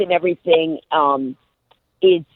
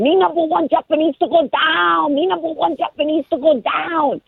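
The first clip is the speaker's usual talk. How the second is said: high, sing-song imitation of a Japanese woman's broken English; mock Japanese accent; 9 semitones higher than usual. Guest mimicking the Japanese woman in the sub, Japanese accent. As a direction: parody her Japanese accent, pitched way up. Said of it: "And she keeps going".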